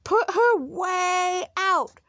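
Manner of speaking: slow, high shout; drawn-out, high-pitched team shout; angry shout. A narrator's reading. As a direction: stretch out the order as you shout. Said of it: "they shouted".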